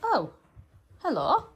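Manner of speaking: high bright hello to the dog; dog greeting. Real, not acted. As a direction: sing out hello to a pet.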